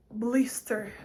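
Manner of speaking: gasp, then breathy whispered word; sudden realization. Retold or imitated. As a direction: act out the word suddenly coming back. Finally recalling the stuck word. (mock gasp of sudden recall)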